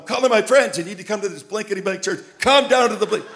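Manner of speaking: high, loud, thrilled phone call; excited caller. Retold or imitated. Healed man excitedly phoning friends about the church. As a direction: hype up your friends.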